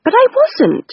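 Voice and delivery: put-on high, sharp girlish objection; indignant younger sister, high; Rose protesting. A narrator's reading. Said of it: "said Rose".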